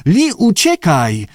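high, drawn-out warning cry; urgent call. A narrator's reading. Abe woła, żeby uciekała. (przeciągłe, wysokie wołanie ostrzegawcze)

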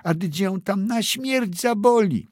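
high, tearful plea; crying plea. A narrator's reading. Boy pleads with his mother to stop, high and tearful. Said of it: "zawołał z płaczem".

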